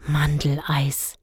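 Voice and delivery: slow, breathless dreamy whisper; boy whispering the flavour longingly. Acted. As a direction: sigh the word Mandeleis like a treat. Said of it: "schwärmte Dennis".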